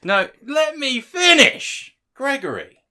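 loud, high, drawn-out protest; exasperated interruption. Real, not acted. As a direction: insist loudly on being heard. Cut off, demands the floor.

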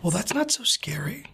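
hushed whisper. Real, not acted. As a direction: whisper back. Quiet reply near the door.

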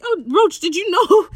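high, loud, amazed question; hammed-up astonishment; mock astonished. Acts out Stede's astonished question.

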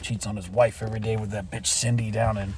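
low, quiet grumble; bitter aside, sunk low and soft; resentful muttering. Acted. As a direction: mutter the grudge under your breath. Secret grumbling about coworker just greeted.

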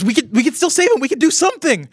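high, loud, frantic begging; desperate plea. Acted. Greta begging the others to save Liam, frantic. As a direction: plead that there's still a way. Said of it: "She says".

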